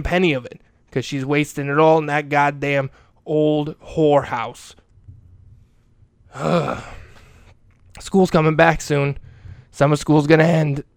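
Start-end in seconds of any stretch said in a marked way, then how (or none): 6.3-7.0 heavy exhaled sigh; weary sigh. Acted. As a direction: let out a weary breath. Dwelling on mother's nursing home costs.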